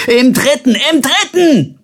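loud, high bellow up the stairs. A narrator's reading. Colbert bellowing up the stairwell, loud. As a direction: roar after the running boy. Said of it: "brüllte".

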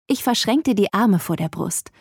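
ordinary delivery, nothing marked

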